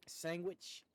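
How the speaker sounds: "Sandwich" said as SANG-gwitch; a G-W sound inside "Sandwich"; imitated pronunciation. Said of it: "she says sandwich".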